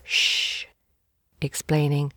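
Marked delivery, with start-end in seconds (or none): 0.0-0.7 hushed, voiceless "shh"; soft cautioning hiss for silence; warning shush. A narrator's reading. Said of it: "And he warned".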